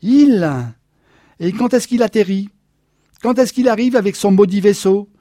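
high, loud furious rant; almost shouting. A narrator's reading. Jealous husband nearly shouting at his wife. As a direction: let the anger boil over. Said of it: "Il hurlait presque".

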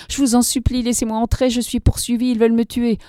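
frightened plea for shelter; desperate begging. A narrator's reading. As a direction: beg her to let you in. Hunted man begs for shelter.

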